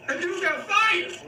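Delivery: comic dismay. Real, not acted. Actor telling how the bit player got fired, high comic dismay. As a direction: wail it high on the edge of laughter. Punchline of his sad story.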